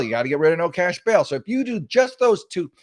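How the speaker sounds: ordinary talk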